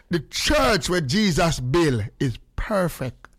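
slow, sung-out Jamaican patois. Shown as a demonstration. Repeating the preacher's line to the crowd, slow sung Jamaican patois. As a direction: chant it slowly in patois for those who don't get English. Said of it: "Let me say it in a patois".

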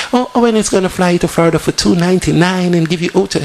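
mock American voice. Host mocks a polished American radio read in American accent. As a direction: slick American ad voice put on briefly as a joke. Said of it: "I never put on my American voice and say".

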